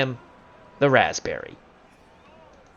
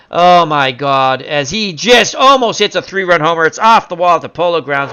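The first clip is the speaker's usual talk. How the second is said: stunned excited call; 3 semitones higher, 8 dB louder than usual. Pretend. Announcer sees Musial almost homer, loud and astonished. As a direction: marvel loudly as the ball carries. Call of a near home run.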